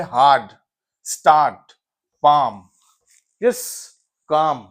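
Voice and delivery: teacher's word list, long "aa" held in each; long vowel examples. Shown as a demonstration. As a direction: say each word alone, stretching the "aa". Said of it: "जिसको खींच के पढ़ते हैं".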